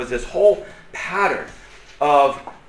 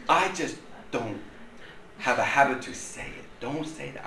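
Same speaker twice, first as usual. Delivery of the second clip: hushed reproof; 3 semitones lower, 11 dB quieter than usual. Retold or imitated. Retold private reproof, spoken low.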